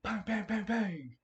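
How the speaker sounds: high bang bang sound effects; gunshot noises. Acted. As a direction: fire off each bang like a gunshot.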